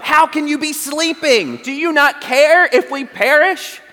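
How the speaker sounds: high, pleading cry of alarm; frantic disciple. Retold or imitated. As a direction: plead like a panicked man in a sinking boat. Preacher voices panicked disciple waking Jesus.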